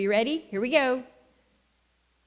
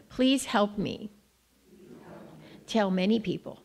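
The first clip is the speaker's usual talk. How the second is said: ordinary talk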